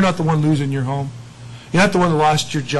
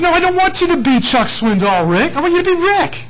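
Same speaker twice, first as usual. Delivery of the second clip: higher, louder gentle correction; voicing God; 10 semitones higher, 4 dB louder than usual. God tells Rick to be himself, higher and louder. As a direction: play God lovingly setting Rick straight. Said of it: "But God says".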